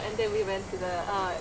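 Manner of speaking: ordinary talk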